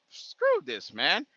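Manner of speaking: high, dismissive scoff; defiant. Real, not acted. Resolving to ignore critics.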